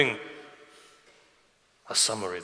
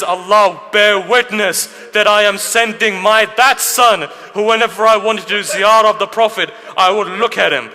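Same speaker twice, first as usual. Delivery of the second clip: anguished prayer; 8 semitones higher, 9 dB louder than usual. Acted. Imam offering his son to God, loud anguished prayer. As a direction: call God to witness with breaking resolve.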